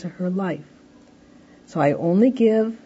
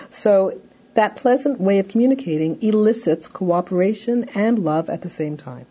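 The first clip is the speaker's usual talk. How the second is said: usual speaking voice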